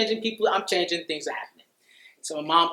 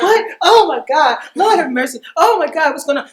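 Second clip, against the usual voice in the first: mother's high, loud retold panic; hammed-up meltdown, pitched way up; freaking out; 9 semitones higher, 8 dB louder than usual. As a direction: imitate a mom losing it on the phone. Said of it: "freaking out".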